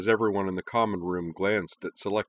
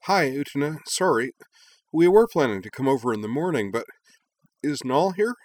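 higher, urgent, a bit out of breath; breathless Hikaru; 7 semitones higher, 5 dB louder than usual. Acted. Hikaru arriving winded, asking for Nal, urgent and breathless. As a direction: rush the words out as if just off a sprint. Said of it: "Hikaru managed to say".

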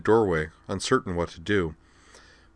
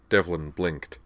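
usual speaking voice

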